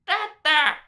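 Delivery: put-on high baby squeal; gurgling baby. A narrator's reading. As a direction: coo it like a delighted baby. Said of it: "he gurgled".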